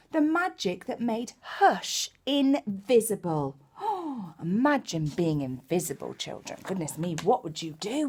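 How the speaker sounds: lively storybook reading; reading to children. Acted. Storyteller reading Possum Magic to young children, animated. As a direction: bring the picture book to life for little ones.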